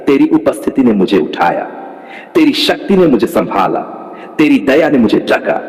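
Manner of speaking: ordinary talk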